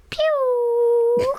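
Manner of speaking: high, loud, drawn-out pew; imitated falling noise. Shown as a demonstration. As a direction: make a long high whistling-down noise. Sound effect for their relationship.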